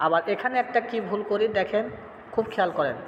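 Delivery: normal talking voice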